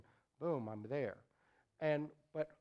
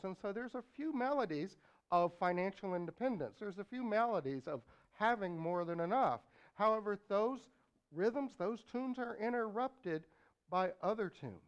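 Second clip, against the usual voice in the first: booming motivational speech; 6 semitones higher, 5 dB louder than usual. Acted. Preacher builds energy.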